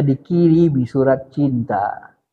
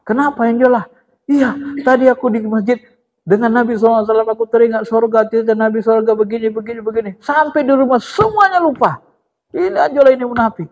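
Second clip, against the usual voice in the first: companion voice; 7 semitones higher, faster than usual. Acted. Retold dialogue with companions.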